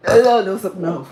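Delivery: loud, alarmed no no no; startled shouting no; loud dismay. Real, not acted. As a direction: cry out in real alarm. Food blowing up in microwave.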